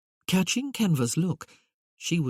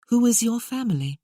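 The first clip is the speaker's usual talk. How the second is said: high duchess voice; 7 semitones higher, 4 dB louder than usual. Acted. Duchess questions Merlin, voiced high.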